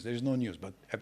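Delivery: ordinary delivery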